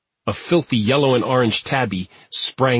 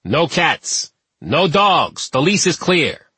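higher-pitched, indignant outburst; furious; 6 semitones higher, slower than usual. A narrator's reading. Superintendent to detectives about a tenant's cat, furious. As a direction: lay down the law in outrage. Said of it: "Pfeiffer said furiously".

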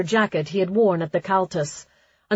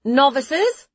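high, loud call over gale; shouting over wind; 7 semitones higher, 5 dB louder than usual. Acted. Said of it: "had to shout above the wind".

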